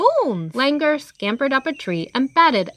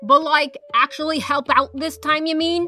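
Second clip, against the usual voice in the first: higher, sassy questioning; sassy character voice; 6 semitones higher than usual. Acted. Peacock's sassy voice.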